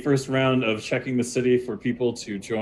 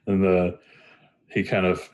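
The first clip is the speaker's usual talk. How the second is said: ordinary delivery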